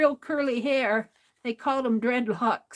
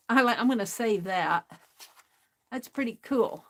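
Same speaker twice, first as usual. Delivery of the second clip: normal talking voice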